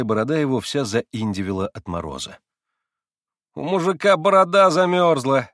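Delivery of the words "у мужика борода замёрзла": a loud, cheeky shout, pitched up; teasing shout. Acted. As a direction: call out to tease the peasant. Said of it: "громко и задирчиво крикнул Коля".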